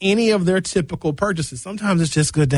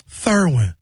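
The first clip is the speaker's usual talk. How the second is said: lisping woman. Retold or imitated.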